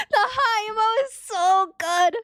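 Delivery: high laughing squeal. Real, not acted. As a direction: talk through giggles. Laughing at emote.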